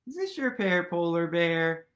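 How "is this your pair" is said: ordinary delivery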